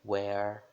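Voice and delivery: WHERE começando com som de U. Shown as a demonstration. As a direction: diga WHERE com um U no início. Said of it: "é o som de U". (WHERE, W pronunciado como U)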